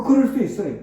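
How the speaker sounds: ordinary delivery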